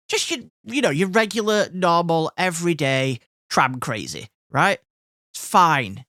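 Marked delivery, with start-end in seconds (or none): none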